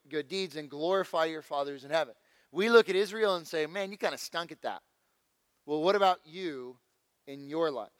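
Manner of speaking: ordinary talk, nothing marked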